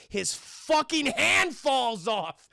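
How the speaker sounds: drawn-out, emphatic reveal; slow emphasis. Real, not acted. Storyteller reveals the gory twist to chat, slow emphasis. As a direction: stretch out every word for the reveal.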